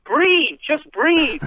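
high, loud pleading breathe; urging breathe. Retold or imitated. Telling a slow talker to breathe, high and loud. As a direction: coax him to breathe, loudly and high. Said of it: "you wanna go".

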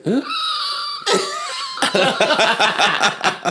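high-pitched shrieking gasp; a sudden high squeal of surprise; dramatic gasp. Retold or imitated. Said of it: "What in the velociraptor was that noise?".

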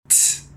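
soft hissed tss; a short soft tss, tongue on teeth; ts sound. Shown as a demonstration. Teacher drills the lone ts sound for students. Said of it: "Primeiro o som sozinho".

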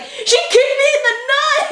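high mock wail through laughter; tattling kid. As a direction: tattle to the teacher in a high, hurt squeal. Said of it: "I couldn't get the words out".

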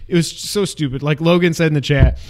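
usual speaking voice

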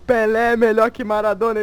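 loud, cocky taunt; taunting shout. Retold or imitated. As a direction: yell the taunt proudly. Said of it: "Aí eu falo".

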